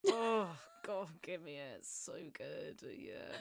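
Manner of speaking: soft, low playful moan of delight; mock swooning. Mock-swooning over the German accent.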